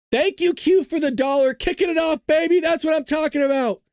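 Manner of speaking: high, hyped-up donation shout-out; excited thanks. Real, not acted. Cheering a viewer's donation.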